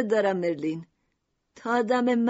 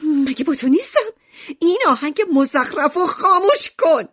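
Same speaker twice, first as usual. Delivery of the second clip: angry scolding; 4 semitones higher, 6 dB louder than usual. Acted. Mother scolds son over music.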